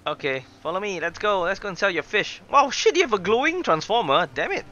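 ordinary talk, nothing marked

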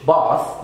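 BATH as "bahth"; British bath; British long A. Shown as a demonstration. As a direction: open the vowel of bath to "ah". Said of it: "بنقول في البريتش إنجلش".

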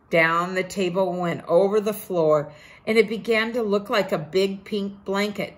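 usual speaking voice

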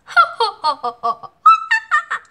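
loud, high staccato ha-ha laugh; mocking group laugh. A narrator's reading. The other animals laugh at the turtle, loud and high. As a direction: laugh big and high in bursts. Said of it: "The other laughed".

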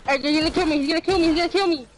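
frantic, rushed panic; blurting in a panic; panicked. Real, not acted. Panics as enemy attacks.

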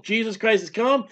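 ordinary talk

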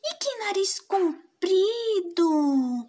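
put-on slow, wondering child's voice; amazed little girl. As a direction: marvel slowly at the big ears. Girl marvels again.

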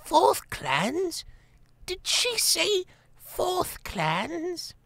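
put-on a confused very old lady mishearing; frail old abbess. A narrator's reading. Old Abbess mishears the guest, high and slow.

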